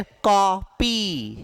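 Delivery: कॉपी slowly, syllable by syllable, open ऑ; open ऑ vowel. Shown as a demonstration. Teacher models कॉपी for students, slow open ऑ. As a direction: stretch the ऑ in कॉपी. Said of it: "का उच्चारण".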